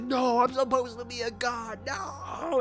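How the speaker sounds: hammed-up death cry. Commander torn apart by zombies.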